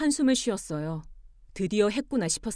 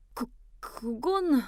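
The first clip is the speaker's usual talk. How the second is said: slow, halting, lost for words; flustered stammer; 4 semitones higher, much slower than usual. Acted. Husband cornered by wife's grievances, stammering. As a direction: falter and stretch it out.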